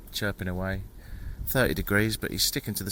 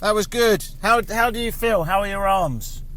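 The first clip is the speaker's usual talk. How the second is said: high, loud call across the riverbank; 6 dB louder than usual. Real, not acted. Praising the boatman from a distance, called out loud and high. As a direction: call out cheerfully to be heard from afar.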